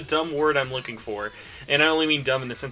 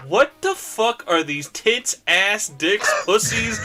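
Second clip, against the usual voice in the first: louder, higher, drawn-out mock disbelief; exaggerated shock, pitched up; mock wifely outrage; 6 semitones higher, 4 dB louder, slower than usual. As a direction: imitate an appalled wife demanding answers. Retelling wife's shocked reaction.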